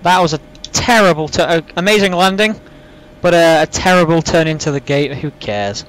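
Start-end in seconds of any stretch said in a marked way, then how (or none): 5.1-5.8 low, dismissive put-on voice; deep joke voice. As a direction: drop into a deep silly voice. Flippant aside in low put-on voice.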